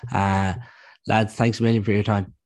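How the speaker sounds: ordinary talk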